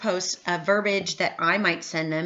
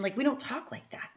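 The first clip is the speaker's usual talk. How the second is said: whispered aside; 5 semitones higher, 6 dB quieter than usual. Real, not acted. Aside after mock pitch.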